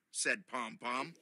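usual speaking voice

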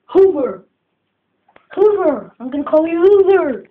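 loud, high call to the pet. Real, not acted. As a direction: call the dog's name across the room. Calling out the pet's name.

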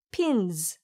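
pins with a soft, light z at the end; light final z. Shown as a demonstration. Teacher repeats pins quietly for students. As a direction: say pins softly, ending on a gentle z. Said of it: "가볍게 발음하세요".

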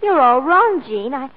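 loud, emphatic outburst; urgent protest. Acted. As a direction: blurt out the news to set her straight. Urgently correcting friend's mistaken belief.

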